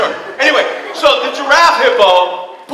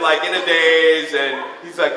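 ordinary talk

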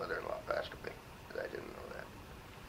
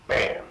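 sudden loud bam; a sharp sound effect for the shock; 10 dB louder than usual. Retold or imitated. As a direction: punch out the impact word. Sudden realization in church.

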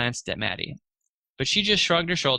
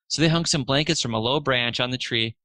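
usual speaking voice

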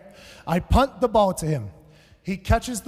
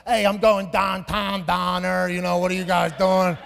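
Pittsburgh accent. Shown as a demonstration. Comic demonstrating his dad's lazy Pittsburgh accent. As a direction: slur it like a Pittsburgh local. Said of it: "He has a deep Pittsburgh accent".

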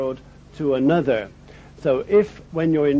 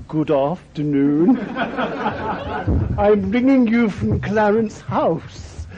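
higher, slow posh lady voice cracking into a laugh; 5 semitones higher, slower than usual. Retold or imitated. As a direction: play the royal caller but can't keep a straight face. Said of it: "this voice said".

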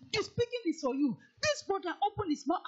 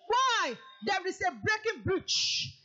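normal talking voice

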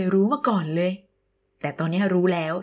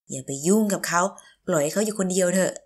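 ordinary talk

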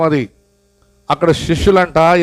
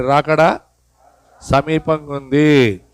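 very slow; much slower than usual. Shown as a demonstration. Preacher leads congregation to repeat, slowly. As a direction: drag సమీపంగా ఉంది out slowly. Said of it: "అందుకు చెప్పండి".

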